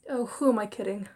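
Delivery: ordinary talk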